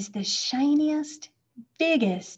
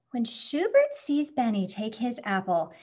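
usual speaking voice

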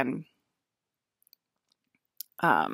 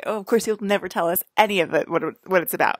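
ordinary talk, nothing marked

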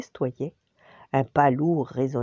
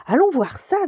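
eager little sister; 13 semitones higher, 7 dB louder than usual. Acted. Curious sister decides.

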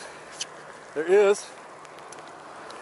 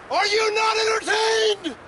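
high, slow, roaring arena cry; gladiator shout; 13 semitones higher, slower than usual. Acted. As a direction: bellow it like a triumphant gladiator. Triumphant gladiator movie quote.

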